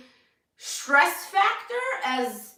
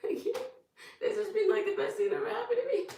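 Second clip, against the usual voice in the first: high, tearful half-laugh; soft, squeaky voice choked by happy tears; crying; 10 semitones higher, 4 dB quieter than usual. Real, not acted. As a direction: laugh through real tears, pitched way up. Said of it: "Oh my God, I'm crying".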